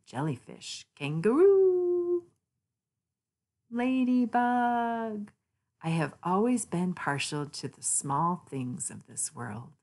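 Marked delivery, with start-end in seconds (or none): 1.0-2.2 much higher, louder and slow; drawn-out high call. Acted. Narrator calls out the kangaroo to children, high and drawn out. 3.7-5.3 higher and very slow; sweet high drawl. Acted. Narrator savours the ladybug's name for children, high and slow.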